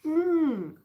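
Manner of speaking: tastes said through a mouthful of cookie; mouth full. Real, not acted. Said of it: "Sorry, full mouth".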